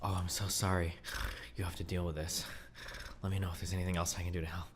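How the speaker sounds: soft creepy mock sympathy; mock a creepy nice guy. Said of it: "makes you sound like a fucking creepy stalker".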